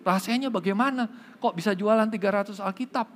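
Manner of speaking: high, eager amazement; begging to know the secret. Acted. Car salesman amazed, asks the stutterer's secret, high and eager. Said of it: "lebih kaget lagi".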